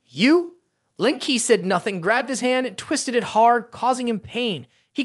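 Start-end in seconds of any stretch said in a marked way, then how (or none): 0.1-0.6 high, louder startled question; sudden surprise. A narrator's reading. Bleeding bald man startled by Lin Ki, surprised. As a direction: snap up in disbelief. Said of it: "suddenly asked".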